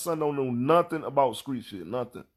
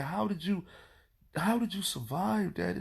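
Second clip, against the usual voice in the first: soft, higher, slowed-down awe; mind-blown daughter; 3 semitones higher, 6 dB quieter than usual. Retold or imitated. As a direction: imitate an amazed kid in a hushed voice. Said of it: "They be mind blown".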